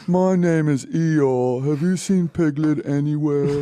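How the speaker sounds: slow, mopey donkey with a hint of English accent; Eeyore voice. Co-host doing a mopey Eeyore impression. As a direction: sound like a gloomy Eeyore. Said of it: "Eeyore has an English accent".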